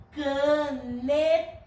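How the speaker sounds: caneta very slowly, each syllable held. Shown as a demonstration. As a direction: chant out ca-ne-ta for pupils to join in. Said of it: "Leiam comigo". (caneta bem devagar, sílaba por sílaba)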